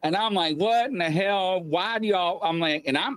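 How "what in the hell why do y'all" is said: retold fury. Slapped awake, confronting wife and grandmother, retold fury. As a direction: act out going off on them, held back. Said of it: "I'm furious".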